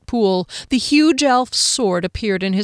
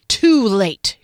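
a louder snarl; 5 dB louder than usual. A narrator's reading. Kian refusing Val, snarling louder. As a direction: spit the words at him. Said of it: "Kian snarled".

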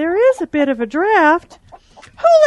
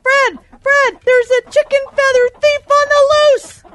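calling Fred; 7 semitones higher than usual. Acted. Hen calling the farmer.

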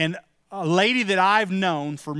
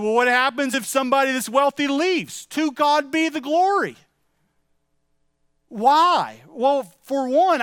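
higher, fervent pulpit preaching; 4 semitones higher than usual. Real, not acted. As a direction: preach it with full conviction. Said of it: "get on a little bit of a podium there".

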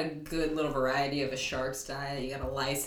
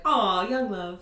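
high, loud mock coo; cooing fish; 6 semitones higher, 7 dB louder, slower than usual. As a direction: coo over the couple like a gushing fish. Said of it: "all the fish inside are just like".

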